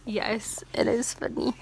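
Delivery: ordinary delivery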